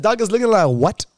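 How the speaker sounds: loud, high doc like what; disbelieving shout. Retold or imitated. Patient shouts disbelief back at the doctor. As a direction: fire the question back in shock.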